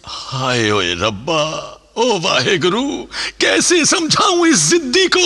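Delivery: anguished lament. Acted. Father calls on God over his stubborn son, rising anguished wail. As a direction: cry out to God in exasperation.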